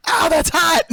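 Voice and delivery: high, loud yelp of pain. Retold or imitated. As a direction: yelp like you just burned your hands. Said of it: "immediately he just goes".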